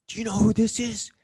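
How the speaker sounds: high, loud, gushing fan voice, fast; hammed-up starstruck dudes raving; over the top fans. Fans raving to his date about him, over the top and high. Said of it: "it was so over the top".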